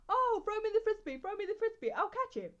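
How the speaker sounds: high, sing-song imitation of an eager guy; mocking boyfriend voice. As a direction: mimic him calling for the frisbee. Mimicking sister's boyfriend calling out.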